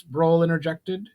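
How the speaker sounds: ordinary delivery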